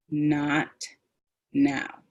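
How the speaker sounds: "Not now" stretched, each word apart; emphasized vowels. Shown as a demonstration. Presenter stopping an upset person, drawn-out "Not now". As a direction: hold the vowels in "Not" and "now", firm and calm. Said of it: "I've emphasized the vowel sounds".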